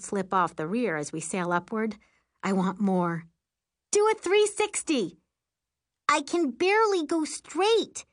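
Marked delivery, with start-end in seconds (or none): 3.9-5.2 very high, thrilled holler; excited shout. A narrator's reading. Passenger shouting a demand in flight. 6.1-8.0 high, slow, strained protest; exasperated shout. A narrator's reading. Pilot shouting back while flying.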